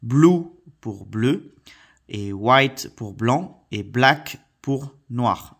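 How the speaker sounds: slow, deliberate word-by-word teacher repetition. Shown as a demonstration. Teacher repeating colours for children, slow and deliberate. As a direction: spell it out slowly for the kids. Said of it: "Donc, je répète".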